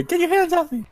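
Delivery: high, frightened woman's cry; distressed woman. Acted. GM voicing Lenin pushing off the ruffian, high and frightened.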